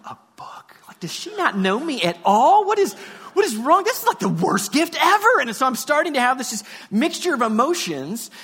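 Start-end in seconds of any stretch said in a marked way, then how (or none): 0.1-0.8 hushed, disbelieving whisper; dismayed whisper. Acted. Child's dismay under the blanket. 0.9-5.4 higher, exasperated protest; childish indignation. Acted. Child outraged at his gift.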